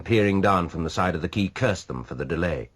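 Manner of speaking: ordinary delivery, nothing marked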